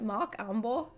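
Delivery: "Mark Amber" with German vowels and no r; badly faked German accent on the name. Shown as a demonstration. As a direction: try a joky German accent. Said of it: "funny to say in a German accent".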